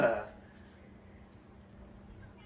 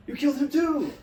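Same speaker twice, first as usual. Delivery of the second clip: much higher, louder joyful shout; excited shout; 11 dB louder than usual. Real, not acted. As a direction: yell it in triumph. Cheering another kill.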